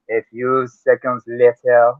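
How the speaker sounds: slow French narrator accent. Shown as a demonstration. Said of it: "'Cause I can sure gonna do it".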